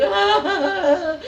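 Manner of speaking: high, loud panicked yell; hammed-up terrified shriek; mock scream. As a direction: scream as if tearing your hair out.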